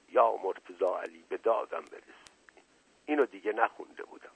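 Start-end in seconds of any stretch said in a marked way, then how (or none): 0.1-2.3 soft mutter under the breath; muttered plea. A narrator's reading. Man mutters to himself as she tags along, under his breath. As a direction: murmur it privately, in dismay. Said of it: "شنیدم زیر لب گفت".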